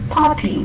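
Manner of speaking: "party" with no r, a falling tone and a long a. Shown as a demonstration. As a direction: model "party" dropping the r. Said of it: "There is no r pronounced there".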